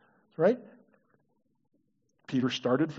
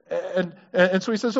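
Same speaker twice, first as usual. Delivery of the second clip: usual speaking voice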